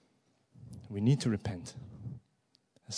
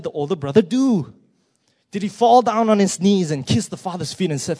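higher and louder, pressing the question; impassioned preaching; 7 semitones higher, 9 dB louder than usual. Real, not acted. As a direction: preach it out with full force. Preacher builds to a climax.

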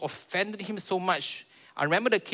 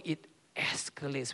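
slow hushed whisper, softer; whispered aside; 3 semitones lower, 7 dB quieter than usual. Real, not acted. Preacher warning the church how talks escalate, whispered. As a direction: drop to a whisper on it.

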